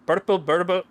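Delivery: loud, high tongue-tied mock Scottish; stumbling loud Scottish accent; fumbled Scottish accent. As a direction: trip over it in a loud fake Scottish.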